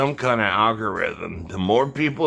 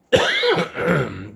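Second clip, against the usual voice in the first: a real rough cough; chesty cough; 6 semitones higher than usual. Real, not acted. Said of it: "I'm coughing because".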